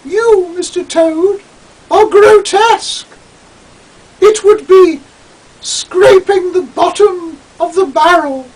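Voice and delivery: put-on snooty girlish Julian. Julian scorning Toad's advances, very high and disdainful. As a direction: look down your nose at Toad. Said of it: "who sounded just like a girl".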